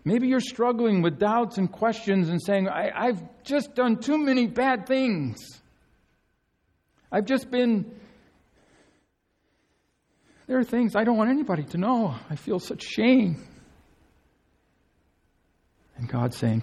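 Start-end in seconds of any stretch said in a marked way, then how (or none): 2.7-13.5 high, rushed confession of shame; voicing a believer weighed down by shame; ashamed confession. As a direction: spill out the shame in a raised pitch.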